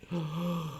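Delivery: sharp imitated gasp of shock. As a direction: gasp as she realises. Acting out her shocked face.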